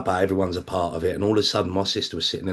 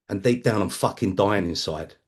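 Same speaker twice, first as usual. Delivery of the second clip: normal talking voice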